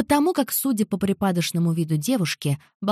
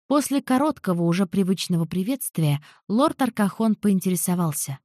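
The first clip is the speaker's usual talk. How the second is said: plain reading voice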